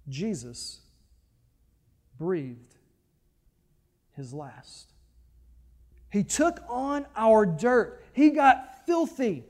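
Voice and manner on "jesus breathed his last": soft, low, spaced with long pauses; hushed. Real, not acted. Preacher telling the death of Jesus, hushed and slow. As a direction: let each word fall away into silence.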